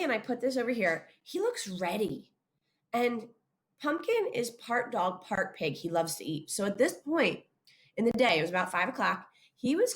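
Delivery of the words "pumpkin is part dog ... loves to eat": ordinary talk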